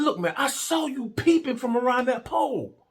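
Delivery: high, exasperated retold accusation; indignant accusation. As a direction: call him out, pitch held way up. Acted quarrel with partner.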